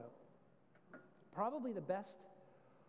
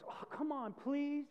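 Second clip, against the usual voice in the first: put-on whining kid; 6 semitones higher, slower than usual. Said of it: "And the kid goes".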